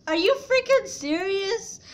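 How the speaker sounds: high, loud, incredulous; outraged disbelief. Retold or imitated. Teen reacting to dad calling the cops, loud disbelief. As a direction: blurt it out in shock. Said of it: "I'm like".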